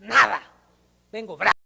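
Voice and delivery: loud, clipped retort of a man who comes in angry; loud retold anger; angry outburst. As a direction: snap it out like you refuse to pray today. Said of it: "Sí, se iba bravo".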